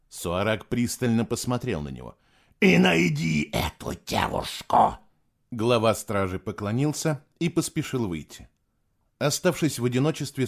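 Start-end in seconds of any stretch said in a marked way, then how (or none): 2.6-5.0 commanding shout. Acted. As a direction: bark the order slowly. Emperor commands.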